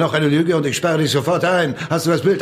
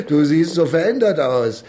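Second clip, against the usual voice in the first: ordinary talk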